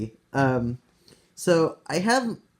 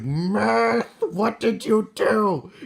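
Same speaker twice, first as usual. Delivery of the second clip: higher, slow mock-furious demand; very angry; 5 semitones higher, much slower than usual. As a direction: draw it out in high, acted anger. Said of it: "I'm very angry at Myth".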